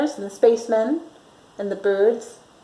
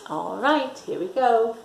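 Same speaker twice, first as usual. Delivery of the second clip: plain reading voice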